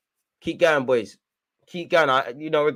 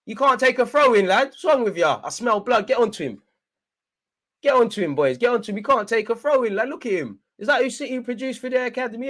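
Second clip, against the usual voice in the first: high, animated heckling from a fan; worked-up, high-pitched jeering; 8 semitones higher than usual. Real, not acted. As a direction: taunt the player and urge the team on, pitched high. Jeering opponent, urging team on.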